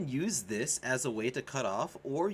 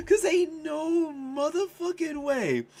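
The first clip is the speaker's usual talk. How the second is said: laughing; 12 semitones higher, 4 dB louder than usual. Real, not acted. Laughing hard at the chat joke, high and broken. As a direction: squeal it out through laughter.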